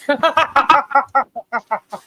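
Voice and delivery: high, long peals of laughter; helpless laughter. Real, not acted. Woman cracking up at her own fart joke, helpless laughter.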